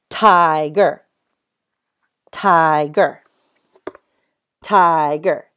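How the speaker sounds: "tiger" drawn out loudly as TI-ger; split into syllables. Shown as a demonstration. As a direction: stretch the long I in "tiger", then "ger". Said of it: "break a word into syllables".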